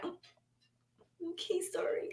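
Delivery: very soft, timid imitation; meek apology. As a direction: shrink back into a tiny apology. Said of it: "And she's like".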